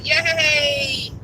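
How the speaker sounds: ordinary talk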